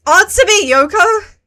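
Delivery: frantic shout for a friend; 1 shouting her name. Real, not acted. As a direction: call out to wake her. Calling unresponsive friend.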